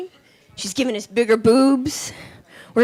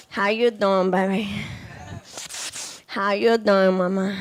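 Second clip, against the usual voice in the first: put-on lower, smooth how you doing baby; 5 semitones lower, slower than usual. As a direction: croon the pickup line low. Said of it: "they're like".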